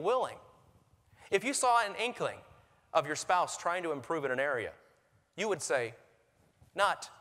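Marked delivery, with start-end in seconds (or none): none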